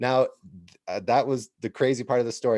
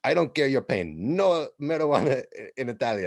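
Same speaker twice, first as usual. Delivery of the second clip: a louder Italian chief in broken English; Italian accent; 3 semitones higher, 4 dB louder than usual. Retold or imitated. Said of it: "He said".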